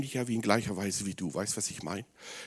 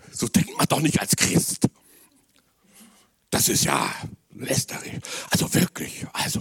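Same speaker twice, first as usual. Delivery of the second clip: a hint of a scandalised pious voice, half laughing; mock pious scold; 3 semitones lower than usual. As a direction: lightly play the shocked churchgoer.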